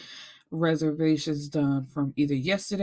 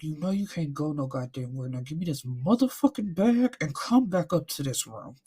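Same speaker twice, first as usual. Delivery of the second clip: through gritted teeth; 3 semitones lower than usual. Retold or imitated. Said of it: "you know how people grit their teeth".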